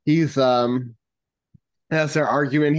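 ordinary delivery

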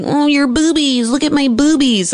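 put-on light childish sing-song; babyish mock voice. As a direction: mimic a silly baby-talker. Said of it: "that word sounds really infantile".

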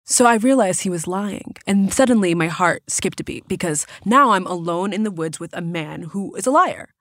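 ordinary delivery

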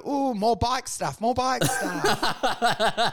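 slow, delighted ooh over bike talk; mock thrilled at bike talk; excited ooh. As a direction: savour it like a breakthrough. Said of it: "you go".

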